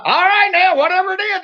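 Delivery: high-pitched call to an intruder; retold challenge shout. Boy challenging the thing in the woods, retold high call.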